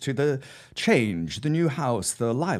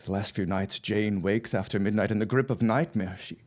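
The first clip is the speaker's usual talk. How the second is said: ordinary delivery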